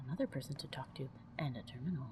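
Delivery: soft unvoiced whisper. Player sneaking through a room, whispering.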